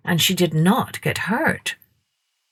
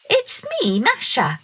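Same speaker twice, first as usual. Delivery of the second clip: put-on much higher child's voice; little girl; 8 semitones higher than usual. A narrator's reading. Masha answering the dog, high and childlike. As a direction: answer brightly as a small girl.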